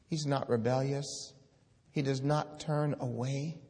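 ordinary delivery

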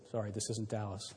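ordinary delivery

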